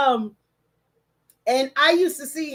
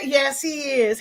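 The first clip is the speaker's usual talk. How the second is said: usual speaking voice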